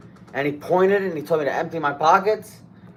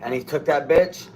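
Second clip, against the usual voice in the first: usual speaking voice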